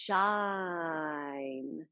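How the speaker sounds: "Shine" slowly enunciated, long sh and held n; slow, long "Shine" with a lifting end; drawn-out shine. Shown as a demonstration. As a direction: stretch every sound of "Shine". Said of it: "you can really accentuate all those sounds".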